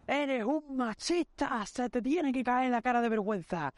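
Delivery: high, drawn-out mock shouting; retold yelling. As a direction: re-enact an outraged yell, high and stretched. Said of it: "me puse a gritarle al tío".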